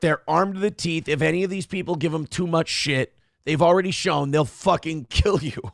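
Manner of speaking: ordinary delivery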